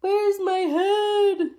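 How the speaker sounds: high, slow, ghostly wail; eerie skeleton voice. Acted. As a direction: stretch it out like a moaning skeleton. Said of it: "the skeleton said".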